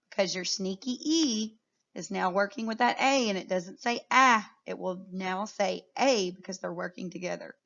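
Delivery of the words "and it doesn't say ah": ordinary talk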